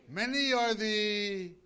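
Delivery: loud, high, drawn-out scripture; preaching declamation. Real, not acted. Preacher quoting scripture to the congregation, loud slow declamation. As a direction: proclaim it slowly at full voice.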